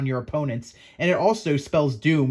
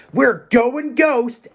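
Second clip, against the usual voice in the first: Danny Phantom catchphrase; 8 semitones higher, 5 dB louder, much slower than usual. Acted. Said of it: "we're gonna be like Danny Phantom".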